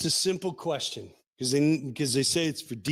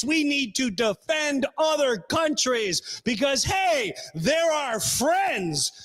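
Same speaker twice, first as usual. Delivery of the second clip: mock-preachy shout, pitched up; sarcastic rant; 7 semitones higher than usual. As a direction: jeer at working Americans.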